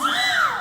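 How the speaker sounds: very high gasp of shock. Acted. Onlooker hearing the murder confession, very high gasp.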